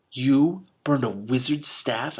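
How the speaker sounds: slow, disbelieving, dragged out; can barely get the words out; stunned. Acted. Stunned boy hearing the staff was burned, slow. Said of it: "I managed finally".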